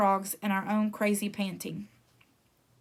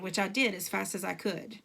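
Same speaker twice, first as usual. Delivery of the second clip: ordinary delivery, nothing marked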